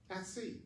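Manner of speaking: "I see." dropped to a soft, separate aside; pause, then a hushed "I see."; a quiet afterthought "I see."; stress on I see. Shown as a demonstration. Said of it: "And I like to say".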